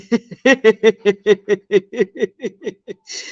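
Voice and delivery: long staccato cackle; real, drawn-out fit of laughter; laughing. Real, not acted. Cracking up with live viewers.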